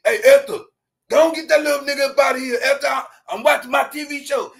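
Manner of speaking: fast, gruff shout of an annoyed grandpa; grandpa yelling. Retold or imitated. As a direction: bark it from the couch, annoyed at the kid.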